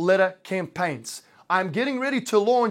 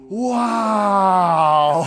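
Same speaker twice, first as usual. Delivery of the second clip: louder, awestruck audience gasp; mock amazed wow; 6 dB louder than usual. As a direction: act like the crowd at an iPhone launch. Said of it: "you guys are supposed to be like".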